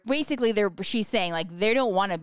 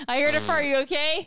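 teasing partner; 7 semitones higher, 3 dB louder than usual. Pretend. Partner mocks at door.

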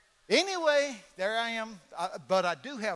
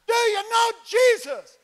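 even higher, slow, loud repeated yell; repeated louder shout; 19 dB louder, slower than usual. Retold or imitated. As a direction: shout it again over the engine noise. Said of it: "See, it's loud in there".

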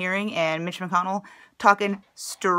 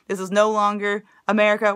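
ordinary talk, nothing marked

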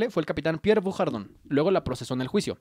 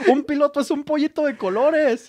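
laughing; 9 semitones higher, 4 dB louder than usual. Real, not acted. Repeats joke laughing.

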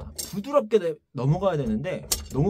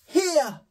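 high 히야 pushed forward; pushed wrong accent; 11 semitones higher, 4 dB louder, much slower than usual. Shown as a demonstration. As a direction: shove the note like Superman. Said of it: "뭔가 이런 식으로".

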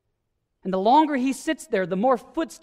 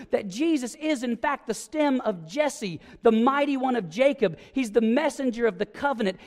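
ordinary talk

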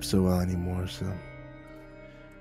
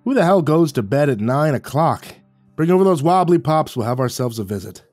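scoffing, louder and higher at first; snorting scoff; 5 semitones higher, 5 dB louder than usual. Acted. Werner waves away the excuse, loud scoffing old man.

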